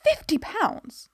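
FIFTY POUNDS high and drawn out; shocked exclamation. A narrator's reading. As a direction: exclaim it in high, slow disbelief. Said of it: "Dot exclaimed".

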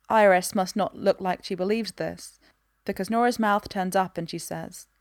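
ordinary delivery

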